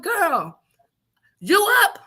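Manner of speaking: high, teasing voice; friend on phone. Retold or imitated. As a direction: check if she's awake, loud and pointed.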